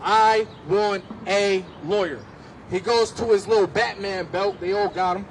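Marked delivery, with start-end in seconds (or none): 0.0-2.2 slow, each syllable separated; every syllable spelled out. Retold or imitated. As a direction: lay down every syllable deliberately. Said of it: "every syllable".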